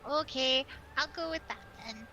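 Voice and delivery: low, resigned acceptance; disappointed. Acted. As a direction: give in reluctantly, pitch dropped. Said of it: "you sound so disappointed".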